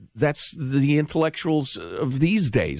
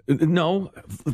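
ordinary talk, nothing marked

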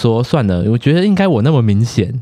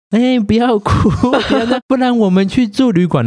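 lightly voiced gentle younger sister; 12 semitones higher than usual. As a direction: coax a drunk mother to calm down. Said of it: "妹就说".

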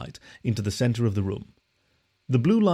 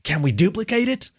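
in a rush; 9 semitones higher, 4 dB louder than usual. A narrator's reading. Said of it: "asked in a rush".

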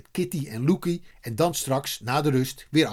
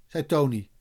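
usual speaking voice

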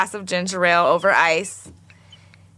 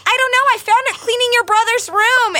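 high, louder clueless grandma; innocent grandma voice; 14 semitones higher, 3 dB louder than usual. Retold or imitated. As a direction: play grandma, unbothered and puzzled.

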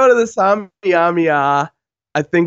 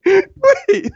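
ordinary talk

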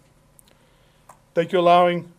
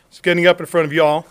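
louder, with an acquired Southern twang; 8 dB louder than usual. Real, not acted. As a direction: let a Southern twang slip out on "y'all". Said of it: "there's my Southern twang".

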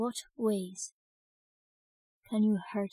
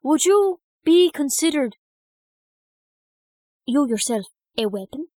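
slow, loud and deliberate; loud, slow question; 5 semitones higher, 10 dB louder than usual. Real, not acted. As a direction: spell out each word loudly.

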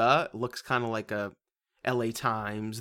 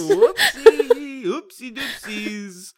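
put-on much higher, louder, laughing baby-talk oopsie; cutesy oopsie; 12 semitones higher, 9 dB louder, much slower than usual. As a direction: coo it out, slowing down at the end. Mock-cutesy echo of whoops.

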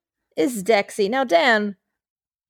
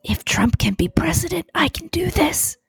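unvoiced whisper, higher at end; 7 semitones higher than usual. Real, not acted. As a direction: whisper it defiantly. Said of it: "you're gonna whisper".

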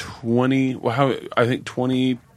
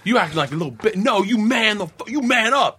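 mock tough dad; 9 semitones higher than usual. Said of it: "like, to his son".

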